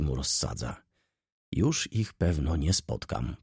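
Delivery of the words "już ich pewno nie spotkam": usual speaking voice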